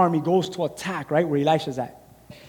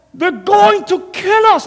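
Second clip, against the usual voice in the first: much higher, slow fearful plea; frightened servant; 12 semitones higher, 7 dB louder, slower than usual. Acted. Servant telling Elisha the army will kill them, fearful plea. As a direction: plead it in terror.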